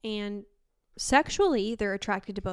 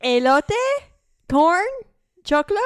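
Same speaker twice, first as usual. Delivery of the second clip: louder, rising, trying each word; desperate guessing; 8 semitones higher, 11 dB louder than usual. Retold or imitated. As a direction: fling each word out hoping one lands. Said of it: "And I'm like".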